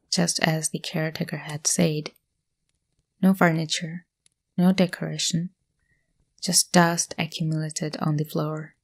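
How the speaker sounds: hushed, measured horror narration; suspenseful story narration. Acted. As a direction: tell it like a creepy campfire tale.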